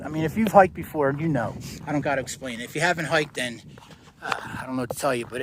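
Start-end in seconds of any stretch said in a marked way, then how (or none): none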